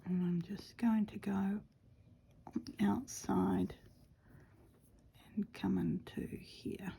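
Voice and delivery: quiet whispered narration while stitching; soft whisper. Acted. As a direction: whisper close to the mic as you work.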